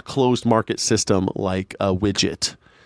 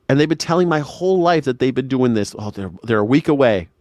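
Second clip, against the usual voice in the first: ordinary talk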